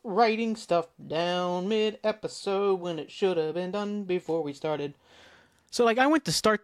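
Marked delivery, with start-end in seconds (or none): 0.0-4.9 slow talk while writing; slow, preoccupied self-narration; distracted, drawn-out. Real, not acted. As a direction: stretch the words out as you jot notes. Talking while writing mid-episode.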